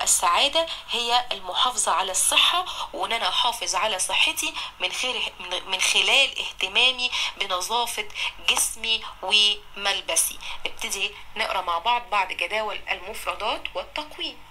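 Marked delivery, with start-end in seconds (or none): none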